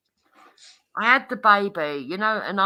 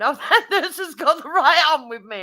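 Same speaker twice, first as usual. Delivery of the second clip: laughing quote; 9 semitones higher, 6 dB louder than usual. Retold or imitated. Quoting husband, laughing.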